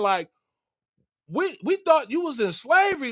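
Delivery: high, incredulous questioning; astonished. Retold or imitated. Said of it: "then they astonished".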